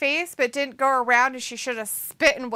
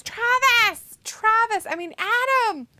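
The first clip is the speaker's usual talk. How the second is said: high, drawn-out name calls; mock calling out; 11 semitones higher, 3 dB louder, slower than usual. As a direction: call the names out high, stretching them. Acting out a viewer's called-out names.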